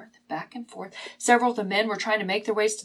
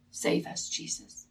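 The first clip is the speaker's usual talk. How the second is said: whispered, fading plea; hushed plea; 7 dB quieter than usual. Acted. Disciples begging Jesus for rescue, hushed plea. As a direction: barely voice the plea, letting it die away.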